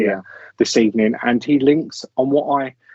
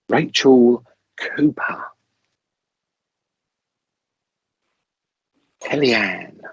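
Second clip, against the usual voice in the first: bingo caller; slower than usual. Real, not acted. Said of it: "Do I sound like a bingo caller?".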